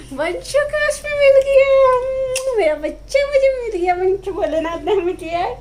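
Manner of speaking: high, slow, tearful and choked up; almost crying with gratitude; tears rising while saying it; voice breaking with emotion. Real, not acted. Thanking god for her child.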